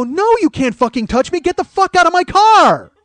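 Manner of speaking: high, loud furious yell; angry yell. Retold or imitated. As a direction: yell at her to get out of the car, furious. Yelling at woman in car.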